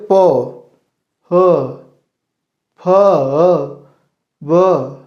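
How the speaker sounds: trying to say प with lips pinched together; lips held shut. Shown as a demonstration. Teacher to pupils, showing प with lips held, muffled. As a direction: sounds come out muffled because the lips are held. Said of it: "पहले होठ पकड़िए ऐसे".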